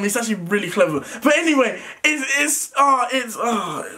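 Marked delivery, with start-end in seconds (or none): none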